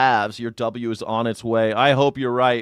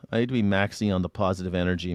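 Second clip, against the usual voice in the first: usual speaking voice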